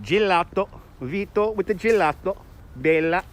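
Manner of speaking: sing-song mock Italian; mock Italian accent. Playful Italian name and food exclamations.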